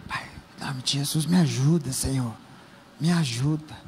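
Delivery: low, soft, murmured little prayer; quiet mumbled prayer. Shown as a demonstration. Pastor showing a weak prayer, murmured low and soft. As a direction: pray almost under the breath, low and soft. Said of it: "invocar ao Senhor é assim".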